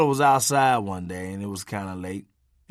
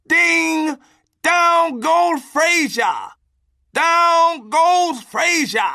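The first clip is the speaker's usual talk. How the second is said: shouted knockout call, much higher, louder and slow; boxing announcer; 7 dB louder, much slower than usual. Retold or imitated. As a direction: roar it like the ref just saw a knockdown.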